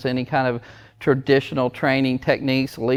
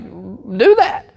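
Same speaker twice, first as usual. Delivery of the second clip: raised-pitch, insistent parent; mock urgent plea; 6 semitones higher, 4 dB louder than usual. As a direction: urge it as the obvious answer. Acted parent urging the doctor.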